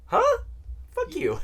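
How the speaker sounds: high squeaky huh, then sharp fuck you; shocked outburst. Retold or imitated. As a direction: react in loud disbelief. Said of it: "And I'm like".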